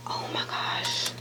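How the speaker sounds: whispered awe; awed whisper. Real, not acted. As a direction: breathe it out in awe. Admiring, whispered aside.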